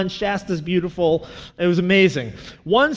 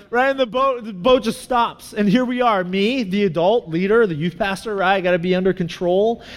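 usual speaking voice

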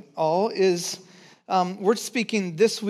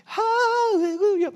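high, slow, drawn-out hallelujah; soaring hallelujah of delight; joyful hallelujah; 13 semitones higher, slower than usual. Retold or imitated. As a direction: sing out the praise, stretched long. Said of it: "I'm like".

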